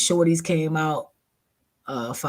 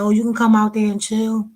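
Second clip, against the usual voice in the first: high, louder put-on sweetness; fake friendly; 10 semitones higher, 6 dB louder than usual. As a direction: sound welcoming but wary. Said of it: "I said".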